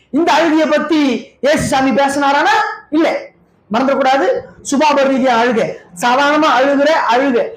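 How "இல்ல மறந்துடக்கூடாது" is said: normal talking voice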